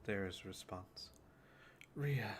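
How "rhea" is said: very soft single word; hushed reply. Acted. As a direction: softly call her name through the stone. Soft telepathic reply over sending stone.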